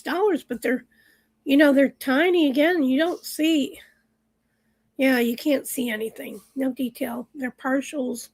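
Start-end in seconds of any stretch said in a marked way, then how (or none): none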